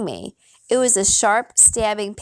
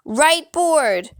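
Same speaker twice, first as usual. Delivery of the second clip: high-pitched warning cry; a shrill shout, held short of full volume; shrill scream; 8 semitones higher, 3 dB louder than usual. A narrator's reading. Witch warning a running boy, shrill scream. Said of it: "A shrill, piercing scream came to my ears".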